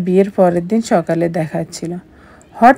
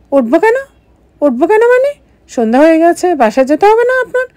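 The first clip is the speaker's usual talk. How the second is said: indignant outburst; 9 semitones higher, faster than usual. Acted. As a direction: fire back in disbelief. Wife indignant at husband staying. (বিস্ময়ে রাগী, উঁচু গলায়)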